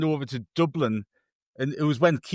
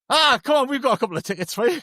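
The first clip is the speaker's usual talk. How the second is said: high-pitched burst at the start, quick after; sudden eager welcome; 10 semitones higher than usual. Retold or imitated. Irish lad offering tickets to a Sunderland supporter, excited. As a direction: imitate a lad suddenly won over.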